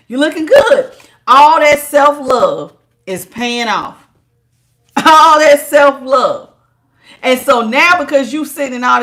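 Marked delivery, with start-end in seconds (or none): 0.1-6.5 excited hype. Real, not acted. Hyping the viewer up.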